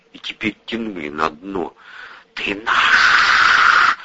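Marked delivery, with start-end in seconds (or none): 2.3-4.0 whispered menacing hiss of the sea; evil hiss. A narrator's reading. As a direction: hiss it like a hungry monster. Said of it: "злобно шипели волны".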